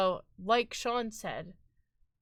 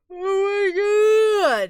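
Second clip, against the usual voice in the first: shocked shriek; 11 semitones higher, 14 dB louder than usual. Real, not acted. Loud outburst on stream.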